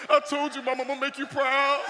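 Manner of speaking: hammed-up emotional cry, high and loud; goofy overcome emotion. Son to his tearful mom, hammed-up emotional. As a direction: wail it proudly, comically choked up. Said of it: "I got a little emotional too".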